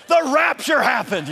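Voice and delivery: high, loud, hammed-up exclamation; playing up the parents' panic for laughs; mock alarm. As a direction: cry it out in mock horror.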